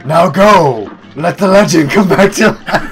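game announcer voice. Acted. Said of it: "this game also has that, like, "Now go!".

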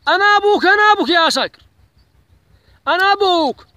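an outraged dad shouting at his son; furious father. Acted. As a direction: hammer home who's the father. Father asserting authority over son.

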